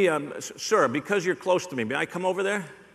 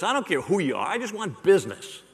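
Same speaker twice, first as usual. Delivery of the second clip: ordinary delivery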